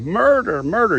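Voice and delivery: high, loud cries of murder; panicked cry for help, retold loud; retold screaming. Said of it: "Fanny screaming".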